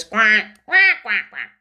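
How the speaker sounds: loud tight duck quacks; duck quacking. Shown as a demonstration. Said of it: "make the duck sound tight like a duck".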